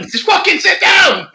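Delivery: high, drawn-out yell of desperation; exasperated shout. Acted. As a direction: shout it slowly at the top of your range. Acted shout at oneself to sit.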